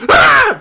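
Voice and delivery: short high-pitched scream; loud scream. Acted. Game master voicing the girl as the door bursts in, loud scream. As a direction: let out a sudden, piercing yelp. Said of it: "you hear a, a loud, like".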